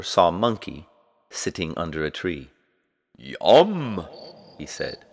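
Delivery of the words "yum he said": greedy monster. A narrator's reading. Monster spots monkey as food.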